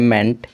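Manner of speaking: मेंट said as "ment", clear and complete; clear model word. Shown as a demonstration. Teacher modelling how to read the suffix मेंट. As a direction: model the ending मेंट once, cleanly. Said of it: "मेंट पढ़ा जाता है".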